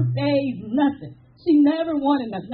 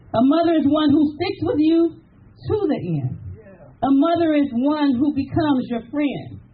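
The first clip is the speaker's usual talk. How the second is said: poem reading; 3 semitones higher than usual. Acted. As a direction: recite it like a tribute poem. Reads sister's poem aloud.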